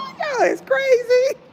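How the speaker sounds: high, slow, squealing through laughter; can barely get words out for laughing; laughing hard. Real, not acted. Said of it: "I am cracking up".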